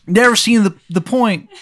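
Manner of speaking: high, loud, badly faked Maryland voice; terrible Maryland accent. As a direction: ham up a cartoonish Maryland accent. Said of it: "sounds like a bad impression of a Maryland accent".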